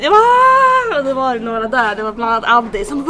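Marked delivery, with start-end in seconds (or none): 0.2-1.0 loud, high scream of joy; sudden high-pitched "aah" shriek; retold scream. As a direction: re-enact screaming right out. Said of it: "så jag skrek ju rakt ut".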